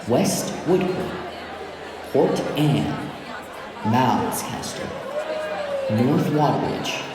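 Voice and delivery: normal talking voice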